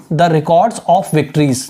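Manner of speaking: very slow, word by word dictation; teacher dictating slowly. Shown as a demonstration. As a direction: spell it out slowly for students to write. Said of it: "इसका काम क्या".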